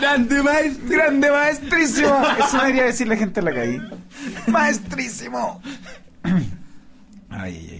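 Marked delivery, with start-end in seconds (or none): 0.0-5.6 autoelogio burlón. Real, not acted. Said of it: "se elogia a sí mismo".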